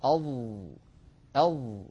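أظ held with the tongue sealed to the palate; za demo. Shown as a demonstration. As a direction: demonstrate أظ. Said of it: "كذلك الظاء". (أظ بإطباق)

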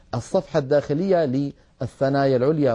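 ordinary delivery

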